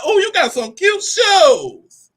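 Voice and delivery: high, slow, sugary shade; fake compliment. Complimenting a judging woman's ugly shoes, slow fake sweetness. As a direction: smile through a backhanded compliment. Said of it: "Give them that fake smile".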